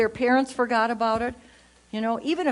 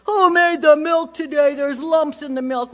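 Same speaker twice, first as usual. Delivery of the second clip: put-on higher, louder complaining child voice; children griping about lumpy milk, higher and louder; whiny kids; 3 semitones higher, 4 dB louder than usual. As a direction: gripe like a kid at the breakfast table.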